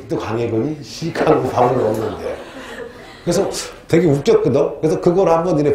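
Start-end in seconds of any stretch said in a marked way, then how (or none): none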